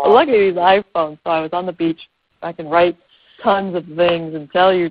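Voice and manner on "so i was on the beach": ordinary talk, nothing marked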